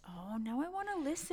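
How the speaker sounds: light up with sudden interest; excited. Real, not acted. Hearing the audiobook praise.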